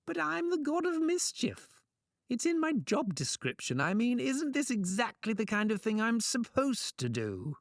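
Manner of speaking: very high, whining self-defence; protesting trickster. A narrator's reading. Trickster excuses his mischief to the king, protesting. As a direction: plead your case in a high voice.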